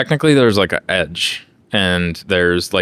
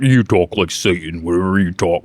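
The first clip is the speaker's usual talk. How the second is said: put-on devil voice; deepened Satan voice. Shown as a demonstration. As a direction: talk like Satan on air duster. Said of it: "it deepens your voice".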